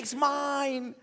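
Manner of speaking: high, strained clinging voice; possessive selfish whine. Acted. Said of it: "you tighten your fist like".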